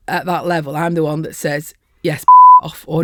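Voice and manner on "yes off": much higher, louder shout of Yes, off; barked order. Acted. Site boss ordering a worker off site, loud shout. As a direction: bark it like a referee sending off.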